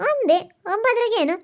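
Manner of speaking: high, drawn-out defiant comeback; defiant retort. Acted. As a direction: stretch it out high, daring them to object. Defiant retort in acted quarrel. (ಸವಾಲಿನ ಎಳೆದ ಎತ್ತರದ ದನಿ)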